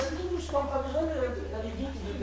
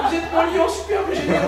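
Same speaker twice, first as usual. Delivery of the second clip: director shows the line a bit higher and brighter; demo of the line; 5 semitones higher, 4 dB louder than usual. Shown as a demonstration. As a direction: give the line an admiring lift. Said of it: "c'est comme ça".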